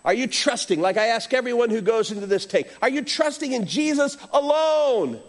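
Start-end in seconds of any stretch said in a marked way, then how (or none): 2.8-5.2 higher pitch, landing on a long "alone"; stress on ALONE. Real, not acted. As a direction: lean hard on the word "alone". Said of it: "It's a big word there".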